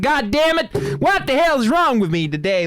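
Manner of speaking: real gaming frustration, pitch jumping up; frustrated outburst. Real, not acted. Frustrated gamer failing a turn.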